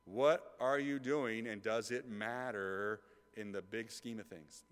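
ordinary talk

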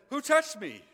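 high, loud call; Jesus calling out in the crowd, loud and high; loud searching question. Acted. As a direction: shout it out over the crowd. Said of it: "And Jesus says".

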